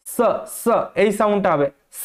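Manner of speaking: স said as a long sharp hiss; snake hiss s. Shown as a demonstration. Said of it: "সাপে যেরকম শিস কাটে". (সাপের শিসের মতো স)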